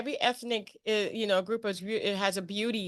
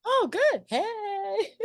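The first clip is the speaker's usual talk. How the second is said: high, delighted burst of joy; real excitement breaking into a laugh; excited squeal; 13 semitones higher, much slower than usual. Real, not acted. Excited reply on the show.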